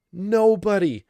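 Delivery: emphatic answer. Real, not acted. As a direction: slam the answer down.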